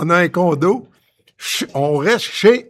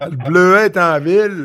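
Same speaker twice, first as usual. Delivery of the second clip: hammed-up brash welcome with a laugh; boisterous colleague; 3 semitones higher, 5 dB louder than usual. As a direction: bellow it like a rowdy old pal arriving. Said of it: "avec sa classe habituelle".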